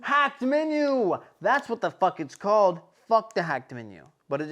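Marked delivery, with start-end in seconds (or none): 0.0-1.2 high, slow drawn-out exclamation; excited outburst. Real, not acted. Hearing the hacked menu name, slow high exclamation. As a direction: stretch it out like a big reveal.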